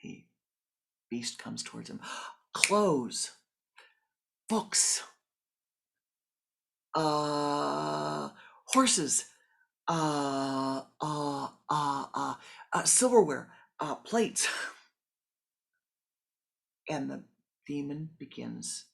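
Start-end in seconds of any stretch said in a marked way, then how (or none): 2.5-14.5 frantic shouting. Acted. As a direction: rattle off demands in a panic. Frantic demands as demon approaches.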